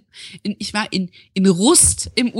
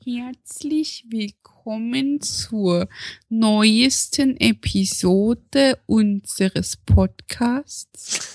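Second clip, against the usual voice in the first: slow Viennese drawl, performed on request; Viennese accent; much slower than usual. Shown as a demonstration. Performing Viennese accent on request, slowly. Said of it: "Komm, mach's".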